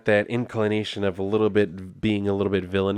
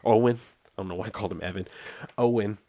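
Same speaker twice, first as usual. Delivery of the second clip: ordinary talk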